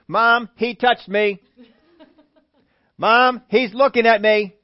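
put-on loud, laughing tattletale child; whiny kid voice. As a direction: whine to Mom like a kid. Kid whining in back seat.

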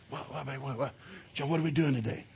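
groggy mumble. Retold or imitated. Groggy husband half asleep.

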